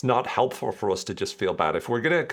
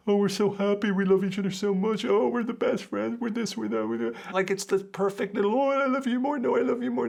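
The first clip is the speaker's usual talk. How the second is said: very high, gushing lovey-dovey voice; mushy couple parody; 11 semitones higher than usual. Pretend. Mocking social media couples in a gushing high voice. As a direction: send up the sickly sweet couple.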